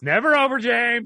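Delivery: loud, high, dramatic wail; dramatic shout. Acted. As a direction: belt it out like a melodrama. Mock dramatic protest.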